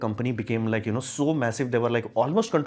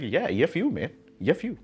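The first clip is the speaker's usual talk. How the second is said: higher, brash mock taunt; mocking defiant companies; 5 semitones higher than usual. Mocking the companies who break the law, a higher brash taunt. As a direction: sneer it like an untouchable corporation.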